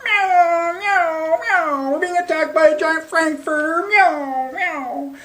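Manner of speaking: high mewing cat voice; panicky cats. Acted. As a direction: meow it like frightened alley cats. Cats fleeing the frankfurter.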